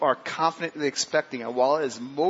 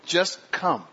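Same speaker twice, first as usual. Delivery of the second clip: longing plea; 5 semitones higher, 5 dB louder, much slower than usual. Acted. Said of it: "I'm saying".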